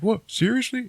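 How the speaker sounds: high squeaky incredulous what; hammed-up surprise at the offer; a light, high seriously; mock disbelief. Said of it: "And he's like".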